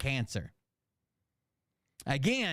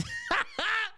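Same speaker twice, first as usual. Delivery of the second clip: gleeful high-pitched cackle; high delighted laugh. Real, not acted. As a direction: laugh with giddy glee. Excited about the drama.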